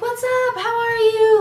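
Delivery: high, loud excited hello; excited greeting. Retold or imitated. Carter greeting the fan again, high and loud. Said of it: "he was like".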